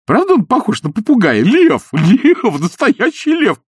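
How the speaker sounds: high, loud ingratiating voice; butter her up shrilly; fawning gush. Acted. Изнуренков fawning over the queen about the cat. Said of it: "заискивающе спрашивал".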